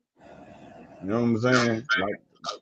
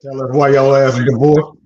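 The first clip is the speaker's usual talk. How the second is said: loud teasing jab; 8 dB louder than usual. Real, not acted.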